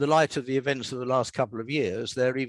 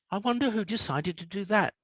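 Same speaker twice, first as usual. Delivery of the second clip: lightly voiced curious boy, a bit higher; boy's inner thought; 8 semitones higher than usual. A narrator's reading. Peter wondering at the brick arch, a light hint of a boy. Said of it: "he thought".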